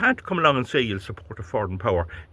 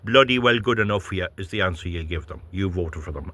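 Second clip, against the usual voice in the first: ordinary talk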